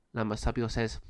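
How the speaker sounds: plain reading voice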